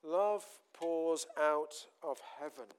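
fervent preaching. Real, not acted. Preaching climax, loud and slow.

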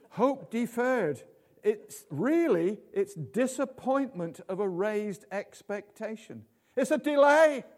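high, loud, slow preaching; full-force sermon delivery. Acted.

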